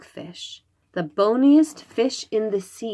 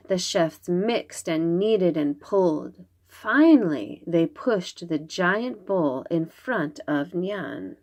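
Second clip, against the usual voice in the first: usual speaking voice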